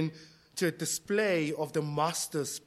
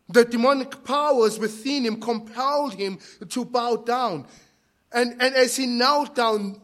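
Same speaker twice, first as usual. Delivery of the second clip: higher, emphatic pulpit voice; forceful preaching; 7 semitones higher, 6 dB louder than usual. Real, not acted. Preacher explaining to church why the demoniac knelt, forceful. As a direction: preach it out with raised pitch.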